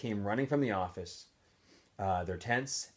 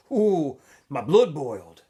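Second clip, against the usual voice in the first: mock anger; 4 semitones higher, 11 dB louder, slower than usual. A narrator's reading. Zigzag acts out rage.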